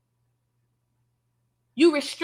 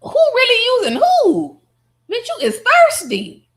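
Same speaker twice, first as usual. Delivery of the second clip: high, loud calling-out, slowing on thirsty; loud taunting; 10 semitones higher, 8 dB louder than usual. Real, not acted. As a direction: roast her for being thirsty. Calling out Marissa's hypocrisy.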